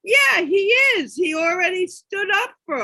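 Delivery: loud, high cheer of praise; excited cheer. Retold or imitated. Parent cheering on the toddler, loud excited praise. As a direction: shout it out like a proud parent.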